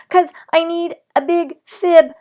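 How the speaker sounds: high, loud pleading; shouting. A narrator's reading. Girl shouts her fib to her mother. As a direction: shout out the confession. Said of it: "I shouted some more".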